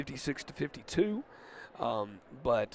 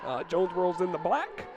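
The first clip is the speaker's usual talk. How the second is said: play-by-play call; 5 semitones higher than usual. Acted. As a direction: call the action to the crowd.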